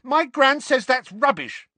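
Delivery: high, loud earnest interjection; piping up. A narrator's reading. Neville defends Dumbledore by quoting gran, piping up. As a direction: pipe up boldly in a high voice. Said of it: "piped up Neville".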